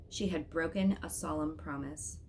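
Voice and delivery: ordinary delivery, nothing marked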